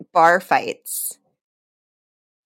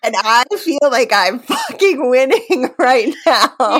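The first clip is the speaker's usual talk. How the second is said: giddy, laughing through the words; crowing with delight, laughing; real laughing glee; 5 semitones higher, 3 dB louder than usual. Real, not acted. Gleeful over wedding invitation.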